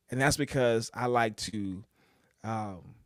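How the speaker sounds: normal talking voice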